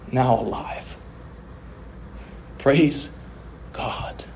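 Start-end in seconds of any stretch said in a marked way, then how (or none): none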